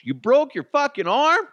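high, loud disbelief; shocked yelp of disbelief; incredulous. Real, not acted. Disbelief at story.

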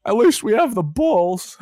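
slow, high, loud mock comfort; mock consolation. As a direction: tease the sad fans. Teasing Chicago fans.

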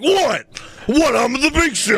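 high, hammed-up indignant squawk; mock Big Show. Mocking Big Show's backstage bit in a high indignant voice. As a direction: play a blustering giant, squeaky and offended.

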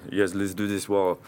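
Eric's lines in a put-on foreign accent. Retelling how the painter agreed to share a wall, in a foreign accent. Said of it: "two different accents".

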